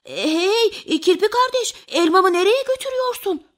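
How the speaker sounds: flustered rabbit hollering to stop someone; alarmed shout. A narrator's reading. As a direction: call out urgently, pitched high. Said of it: "Tavşan arkasından telaşla".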